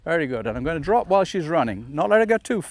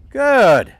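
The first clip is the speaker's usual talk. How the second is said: sharp praise; 6 semitones higher, 8 dB louder than usual. Real, not acted. Praise after jump.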